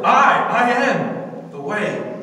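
"I" punched loud and high, then "I am" drawn out; booming stress on "I, I am"; emphatic. Retold or imitated. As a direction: hit "I" hard, then slowly declare "I am the way". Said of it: "He said it emphatically".

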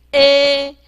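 a as a slow, level "ay" without a glide; 字母发音示范. Shown as a demonstration. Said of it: "我们念a". (平稳慢慢地念成“ê”)

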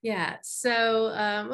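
ordinary delivery

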